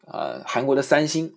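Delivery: ordinary talk, nothing marked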